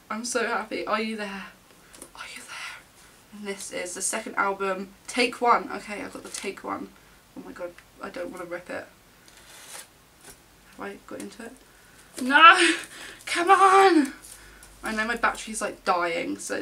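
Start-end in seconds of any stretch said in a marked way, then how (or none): 2.1-3.3 slow, hushed whisper; whispered question. Real, not acted. Searching inside album, under her breath. 12.2-14.1 loud, high wail of protest; frustrated cry. Real, not acted. Frustrated cry while opening album.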